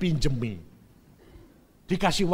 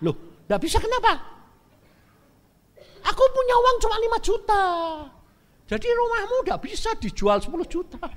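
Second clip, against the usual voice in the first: heated haggling; 5 dB louder, faster than usual. Retold or imitated. Buyer argues back with seller.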